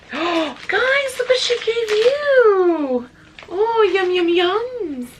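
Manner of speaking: very high, slow, cooing to the dogs; pet voice. Real, not acted. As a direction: coo at the dogs over their gifts. Showing gifts to dogs.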